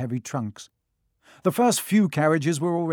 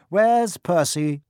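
mother; 5 semitones higher, 6 dB louder, slower than usual. A narrator's reading. Mother asks about Percy.

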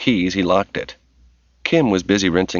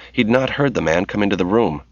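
ordinary delivery

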